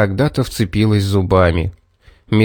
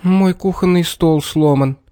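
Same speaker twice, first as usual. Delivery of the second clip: upset; 7 semitones higher than usual. A narrator's reading. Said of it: "огорчилась мама".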